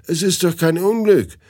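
usual speaking voice

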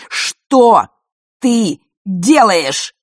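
loud, high, slow angry demand; furious shout. A narrator's reading. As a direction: shout it in her face. Father furiously scolds daughter. (отец в ярости кричит на дочь)

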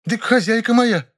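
very high-pitched indignant woman; blurted indignantly. A narrator's reading. Nyura tells the narrator about her mistress, indignant. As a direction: blurt it out as if obvious. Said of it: "выпалила Нюра и продолжила возмущаться".

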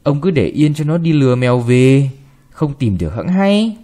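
usual speaking voice

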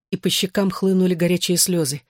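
plain reading voice

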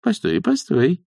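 high, slowed interjection; intervening nanny. A narrator's reading. Nanny cuts in between the witches, interceding. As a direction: step in to halt the quarrel. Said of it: "вмешалась нянюшка".

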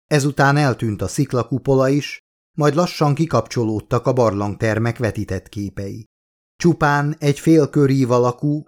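plain reading voice

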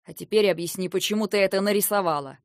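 loud, forceful order; barked demand. A narrator's reading. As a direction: bark the question out. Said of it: "рявкнул Линтар".

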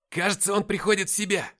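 high, loud announcement; excited call. A narrator's reading. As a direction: call out that the patient stirs. Rescuer calls out as patient wakes.

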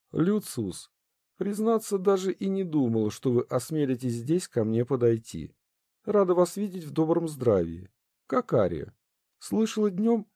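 a polite princess, lightly voiced. A narrator's reading. Princess character voice.

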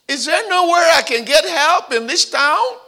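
high, loud, tearful cry for help; mock crying plea. Stranded woman begging God for help, high loud tearful plea. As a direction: plead with God near tears.

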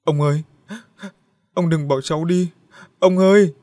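rising to a high, loud tearful wail; wailing in tears. Acted. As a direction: cry out to grandpa, breaking into a scream. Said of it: "cô gào lên trong nước mắt". (gào khóc thảm thiết, càng lúc càng to)

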